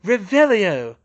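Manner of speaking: high, loud shouted incantation; sudden commanding shout; shouted spell. A narrator's reading. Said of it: "a voice shouted".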